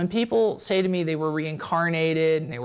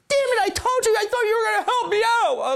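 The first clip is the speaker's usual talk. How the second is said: much higher mock whine, half laughing; hammed-up petulant moan, laughing through it; whiny complaint to God; 13 semitones higher, 3 dB louder than usual.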